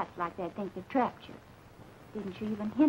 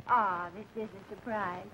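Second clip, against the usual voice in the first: normal talking voice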